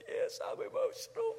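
father imitating his daughter admitting she's emotional; little daughter. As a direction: play the nine-year-old owning it through a laugh. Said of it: "And she said".

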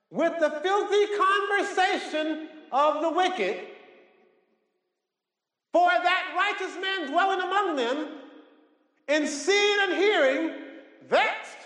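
very high, drawn-out declamation; preached scripture at full force. Acted. Preacher reading of judgment to the congregation, high-pitched proclamation. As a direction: proclaim it high and slow, phrase by phrase.

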